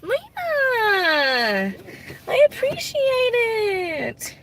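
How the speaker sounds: high, slow, close to tears; moved to tears, voice breaking; teary thanks. Real, not acted. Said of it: "You're gonna make me cry".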